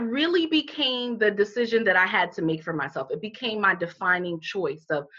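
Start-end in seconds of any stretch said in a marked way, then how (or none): none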